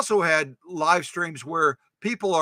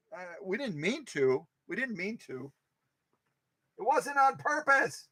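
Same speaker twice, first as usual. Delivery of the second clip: much softer, sheepish apology; meek apology; 3 semitones higher, 8 dB quieter than usual. Pretend. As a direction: shrink back and apologise. Meek reply to imagined activists.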